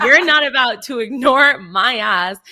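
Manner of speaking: loud, slow sassy declaration; indignant retort. Retold or imitated. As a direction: hammer it out with outraged sass. Retelling her indignation at being ignored.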